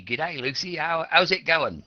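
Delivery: a casual Australian mate saying hi; Aussie greeting. Shown as a demonstration. Teacher greets student Lucy, mild casual Australian hello. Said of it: "if I greeted you, Lucy".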